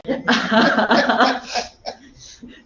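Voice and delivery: high, loud burst of laughter. Real, not acted. Teacher laughing at the student story, loud laugh.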